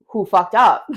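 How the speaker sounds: loud, blunt retold accusation; mock angry demand. Daughter confronting her divorcing parents, mock angry.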